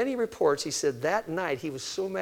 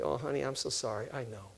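softer, gentle mock sympathy; soothing mom; 3 semitones lower, 9 dB quieter than usual. Mother calmly soothing her whining daughter, softly. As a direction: coo it like a patient mother.

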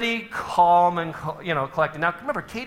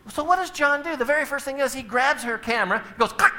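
usual speaking voice

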